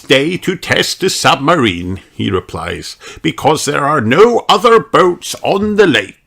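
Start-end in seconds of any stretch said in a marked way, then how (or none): 0.0-2.0 put-on high, lively voice of an old fairy king; King Thistle's voice. King tells the children about the test. 3.2-6.2 put-on high, lively voice of an old fairy king; King Thistle's voice. King tells the children about the test.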